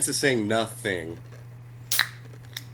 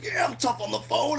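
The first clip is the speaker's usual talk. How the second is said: much higher, sneering bully voice on "phone"; mocking tough guy; 11 semitones higher, 4 dB quieter than usual. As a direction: gloat down the payphone while beating him. Voicing the guy beating Bill.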